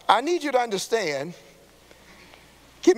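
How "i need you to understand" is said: usual speaking voice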